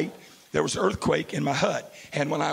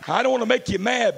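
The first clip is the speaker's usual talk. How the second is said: ordinary talk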